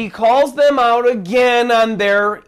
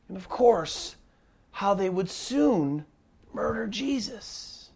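ordinary talk, nothing marked

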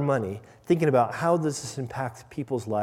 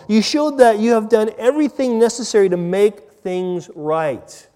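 loud, high, emphatic preaching; slow, forceful, ringing proclamation; impassioned scripture reading; 7 semitones higher, 8 dB louder than usual. Acted. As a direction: punch each item of the list with rising fervour.

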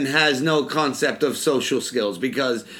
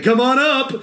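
high, louder playful call to the dog; mock excited call; 10 semitones higher, 5 dB louder than usual. Brother-in-law eagerly calling the dog to jump up. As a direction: egg the dog on like a pal. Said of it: "he's like".

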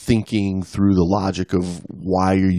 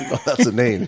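mock Australian accent; 3 semitones higher than usual.

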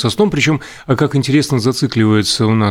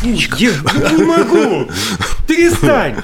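laughing; 12 semitones higher, much slower than usual. Real, not acted. Breaks up laughing at the whisper.

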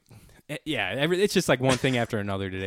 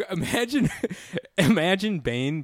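usual speaking voice

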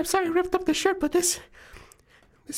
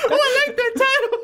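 laughing; 10 semitones higher, 3 dB louder than usual. Real, not acted. Players cracking up over name.

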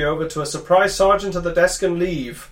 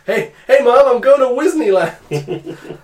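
put-on high, louder, slow childish call; excited kid voice; 6 semitones higher, 5 dB louder, slower than usual. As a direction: shout it like an eager kid. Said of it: "so we go".

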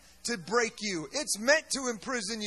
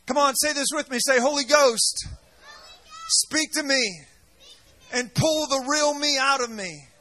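shouting fiery sermon to the crowd; rally the congregation, loud and rousing; preaching at full force; 5 dB louder than usual. Real, not acted. Preacher rousing his congregation, fiery shout.

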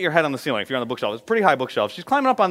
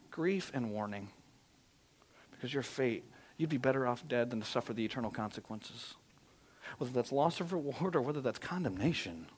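much softer, low grieving warning; solemn and quiet; 3 semitones lower, 11 dB quieter than usual. Real, not acted. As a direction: lower the voice in grief.

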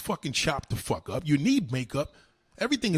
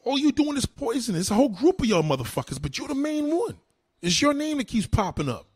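angry rant; 8 semitones higher than usual. Real, not acted. Ranting insults at a rival.

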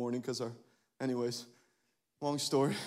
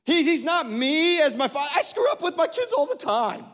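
a higher, louder preached admission; preaching confession; 12 semitones higher, 8 dB louder than usual. Acted. Pastor admitting he fails his kids, preached loud. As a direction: push the confession out with energy.

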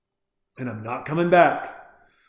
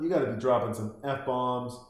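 ordinary talk

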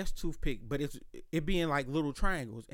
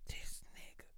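voiceless exasperated whisper; 18 dB quieter than usual. Retold or imitated. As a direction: breathe it out barely audible, fed up.

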